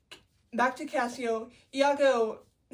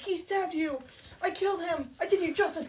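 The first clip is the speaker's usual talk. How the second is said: higher-pitched acted self-justifying; mock earnest protest; 6 semitones higher than usual. Iago explaining himself to Cassio, mock earnest. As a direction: plead your case, hammed up.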